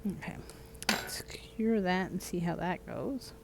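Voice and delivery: unvoiced soft whisper. Real, not acted. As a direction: whisper it to yourself.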